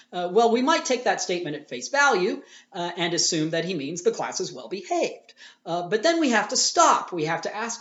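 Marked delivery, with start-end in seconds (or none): none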